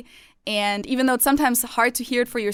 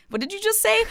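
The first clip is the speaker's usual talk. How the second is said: much higher, puzzled question; baffled boyfriend; 11 semitones higher than usual. Retold or imitated. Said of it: "he'll be like".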